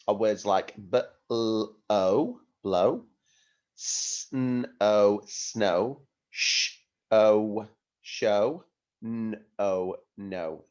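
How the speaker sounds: b-l-o then blow, letters sounded slowly then blended; sounding out. Shown as a demonstration. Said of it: "sounding out letters then word".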